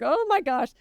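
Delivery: high, embarrassed oh my gosh; mortified gasp. Retold or imitated. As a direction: squeal it out, caught red-handed. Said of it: "And I was like".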